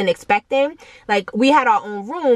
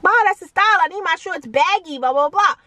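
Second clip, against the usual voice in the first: put-on higher pleading teen voice insisting on the style; whiny kid; 8 semitones higher than usual. Kid arguing with mom about baggy shorts, whiny and high. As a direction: beg mom like a stubborn kid.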